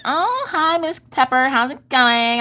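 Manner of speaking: high-pitched, drawn-out friendly greeting; chirpy office lady. Retold or imitated. Imitates office lady greeting her.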